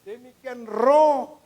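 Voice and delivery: very slow, loud, high opening of the verse; drawn-out loud proclamation; booming scripture. Acted. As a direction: boom it out from the pulpit. Reading scripture with force.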